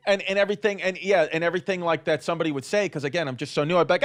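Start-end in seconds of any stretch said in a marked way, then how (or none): none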